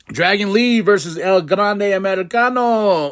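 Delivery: hammed-up announcer call; mock ring announcer. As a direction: announce the matchup slowly with a flourish. Hypes the match billing.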